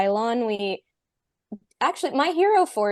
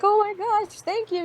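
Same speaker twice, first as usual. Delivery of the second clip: high-pitched squeal of thanks; mock-squeal with delight, much higher; retold squeal; 13 semitones higher, faster than usual. Player retelling thanking the dungeon master for a princess title, squealing. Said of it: "I, like, squealed".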